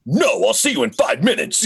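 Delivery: high-energy mock announcer reply; promo announcer. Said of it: "that's his voice".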